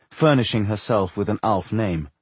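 plain reading voice

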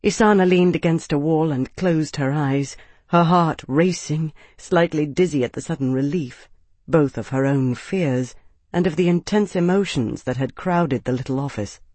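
ordinary delivery, nothing marked

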